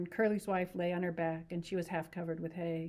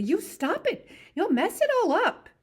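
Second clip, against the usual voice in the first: much higher, louder angry outburst; angry cry; 14 semitones higher, 11 dB louder than usual. Acted. Curly's wife to Lenny, furious high cry. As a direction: snap at him furiously. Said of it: "she cried angrily".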